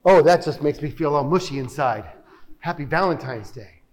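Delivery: low, unimpressed mock gushing; deadpan sarcasm. Reacting to a loveless Valentine's line, deadpan sarcastic. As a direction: feign being touched, voice dropped low.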